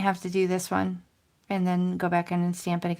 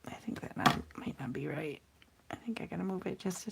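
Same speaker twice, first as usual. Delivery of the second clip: whispered, much softer doubt; 10 dB quieter than usual. Real, not acted. Crafter doubting the alignment, whispered. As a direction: murmur it under your breath.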